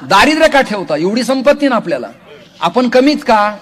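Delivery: high, loud challenge to the crowd; preaching at full force. Acted. Preacher challenging the audience, high and loud. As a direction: rouse them, demand an answer.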